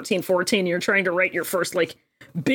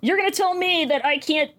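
indignant, raised-pitch challenge; still mad; 7 semitones higher than usual. Real, not acted. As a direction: throw it back in high-pitched outrage. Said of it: "I'm still fucking mad about this".

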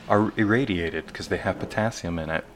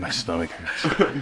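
laughing mock pain; 5 semitones higher, slower than usual. Pretend stomach pain.

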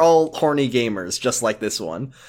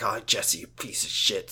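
hushed whispered insult; mock whisper; 7 semitones lower, 10 dB quieter than usual. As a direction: whisper it under your breath. Laurie voiced in retelling.

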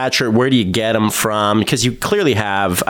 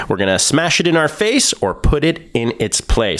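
ordinary talk

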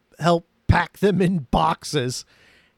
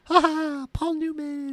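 high, coy mock giggle; put-on giggly lover, high-pitched; imitated giggling; 9 semitones higher, 4 dB quieter than usual. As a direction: giggle the name playfully. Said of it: "and you hear giggles".

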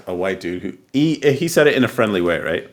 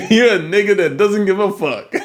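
high, laughing echo of the line; 12 semitones higher, 3 dB louder than usual. Real, not acted. Friend echoes the other's bold answer back, laughing. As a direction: repeat it through real laughter, pitched up.

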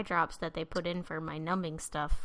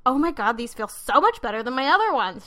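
loud, gushing relief; excited; 4 semitones higher, 9 dB louder than usual. Retold or imitated. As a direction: gush about how good they feel. Retold excitement about new contacts.

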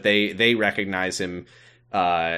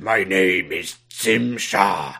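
slow, drawn-out alien announcement; alien introducing himself; 3 semitones lower, slower than usual. Acted. Evil space alien arriving at Ellis Island, slow mock-menacing. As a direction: intone it slowly as a grand evil alien. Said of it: "and he said".

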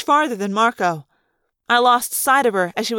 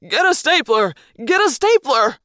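frantic yell, climbing on the repeat; panicked yelling; 3 semitones higher, 4 dB louder than usual. A narrator's reading. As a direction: yell a senseless order in panic. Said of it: "yelling ridiculous directions in total panic".

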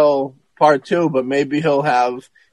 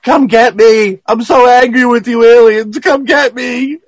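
higher and louder, daring the aliens; mock yelling; 9 semitones higher, 7 dB louder than usual. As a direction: shout a desperate challenge up high. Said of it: "he's yelling".

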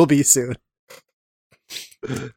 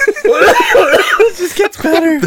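much higher, louder, slow words through laughter; laughing hard; 7 dB louder, much slower than usual. Real, not acted. Co-host laughing at the botched reading, much higher and louder. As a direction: barely get it out for laughing.

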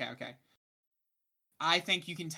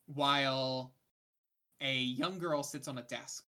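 usual speaking voice

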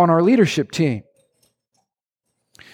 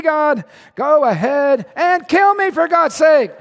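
exasperated Moses; 6 semitones higher than usual. Acted. Preacher voicing Moses ranting at God, high exasperated plea. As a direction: read Moses as fed up and begging. Moses' climactic rant continues.

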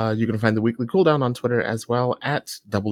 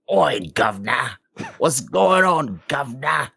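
loud, higher, hammed-up Cockney; mock Cockney accent; 5 semitones higher, 3 dB louder than usual. Shown as a demonstration. Guest showing his co-hosts his usual British impression, exaggerated Cockney. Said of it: "it's always Cockney".

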